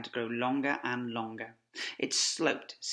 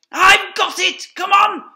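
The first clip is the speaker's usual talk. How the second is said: very high, loud excited shout; 19 dB louder than usual. Acted. Fox rallying his family, excited shout. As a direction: burst out with the idea. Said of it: "He jumped up and shouted".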